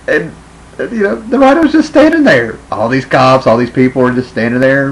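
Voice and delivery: high, loud exasperated disbelief; worked-up, high-pitched incredulity; incredulous ranting. Real, not acted. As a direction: raise your voice at how absurd it is. Incredulous rant at absurd film scene.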